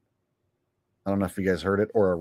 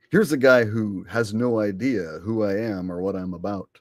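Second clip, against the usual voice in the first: ordinary talk, nothing marked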